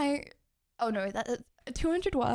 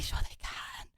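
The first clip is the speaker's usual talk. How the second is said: whispered aside; 6 dB quieter, slower than usual. Real, not acted.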